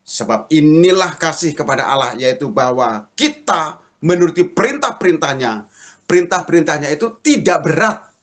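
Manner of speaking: scripture proclamation. Acted. Preacher recites the verse to congregation, emphatic proclamation.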